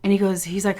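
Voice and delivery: usual speaking voice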